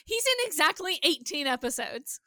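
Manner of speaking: ordinary talk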